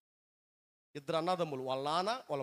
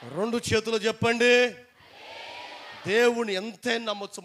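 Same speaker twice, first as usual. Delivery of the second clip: 1 rallying call; 5 semitones higher, 9 dB louder than usual. Real, not acted. Calling congregation to praise.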